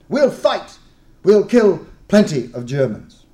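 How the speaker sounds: loud, high rousing vow; rally everyone to fight; fierce battle cry. Acted. Host fierce and joyful.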